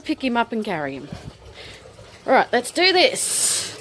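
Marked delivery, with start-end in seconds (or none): none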